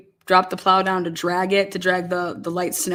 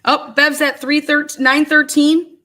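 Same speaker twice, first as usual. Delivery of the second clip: excited; 7 semitones higher, 7 dB louder than usual. Real, not acted. Reacting to the judge calling in.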